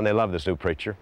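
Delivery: normal talking voice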